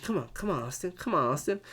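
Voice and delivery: hushed, low mock coaxing; very soft retold urging; imitated pushing. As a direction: imitate quiet, repeated nudging under your breath. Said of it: "Xavier Woods keeps pushing".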